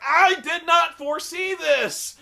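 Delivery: loud, high and drawn out; hammed-up disbelief of a victim; mock shocked wail. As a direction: cry it out slowly in fake astonishment. Mocking third victim's supposed surprise.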